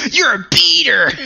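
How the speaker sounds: high, loud accusing yell; accusing shout. Retold or imitated. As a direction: hurl the accusation. Quoting anime crowd accusation.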